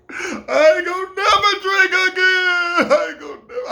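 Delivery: high, loud sobbing shout; crying vow. Retold or imitated. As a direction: swear it off through tears. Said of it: "I think that's when I cried".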